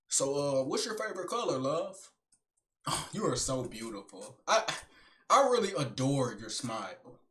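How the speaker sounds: low, soft sweet talk; demonstrating a tender, hushed compliment; smooth flirting. Shown as a demonstration. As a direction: woo gently, lowering your voice. Demonstrates sweet-talking a woman.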